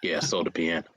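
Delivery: low, soft, offhand imitation; imitating a nonchalant confession; mock cool. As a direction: play it far too casual, dropped low and quiet. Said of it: "so, so coolly".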